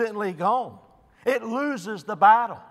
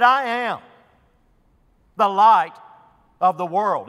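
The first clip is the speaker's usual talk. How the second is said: "I am" and "light" punched louder, falling hard at the end; emphatic claim; 5 dB louder than usual. Retold or imitated. Preacher quoting Jesus to the congregation, emphatic stress on "light". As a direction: declare it, leaning on "light". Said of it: "he made it very emphatically".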